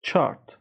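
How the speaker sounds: Chart with the ar held long and high; Chart, a long ar vowel; drawn-out ar. Shown as a demonstration. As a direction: stretch the ar in Chart.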